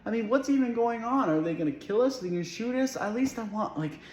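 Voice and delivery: high-pitched, exasperated rambling; worked-up rant. Acted. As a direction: pitch it well up, overwhelmed by it all. Worked-up rant about toe and missiles.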